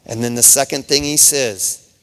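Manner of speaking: ordinary talk, nothing marked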